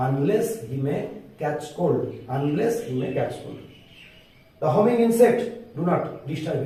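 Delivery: slow, word-by-word English with long pauses; speaking slowly. Shown as a demonstration. Teacher reading poem lines to students, slow and deliberate. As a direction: spell it out slowly so students can follow. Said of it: "আস্তে আস্তে বলছি".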